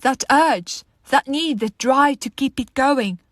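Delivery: loud, urgent existential plea; impassioned questioning. Acted. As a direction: demand answers, pushing the key words hard. Monologue builds to desperate questioning.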